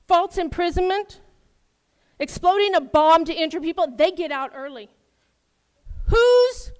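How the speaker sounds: real anger. Real, not acted. Resident listing crimes to the council, angry and incredulous. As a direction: fire off each charge, then demand who's responsible. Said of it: "this stuff just makes me angry".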